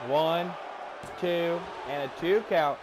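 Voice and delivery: slow, building play-by-play call; wrestling commentator. Acted. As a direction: stretch the move name for drama.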